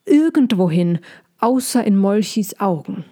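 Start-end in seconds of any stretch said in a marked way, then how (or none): none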